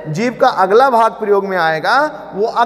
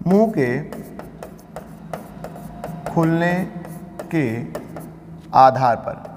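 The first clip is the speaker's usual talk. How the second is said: slow dictation; 4 semitones lower, 5 dB quieter than usual. Shown as a demonstration. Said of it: "dictating slowly word by word".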